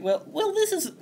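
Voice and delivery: sweet and gracious, with the pitch lifted; lovely voice. Retold or imitated. Mayor musing aloud on the cult news in a lightly lifted lovely voice. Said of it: "in her lovely voice".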